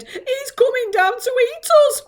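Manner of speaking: high, loud fearful wail; old grasshopper wailing in fright. Acted. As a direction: wail it like doom is coming. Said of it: "wailed the old green grasshopper".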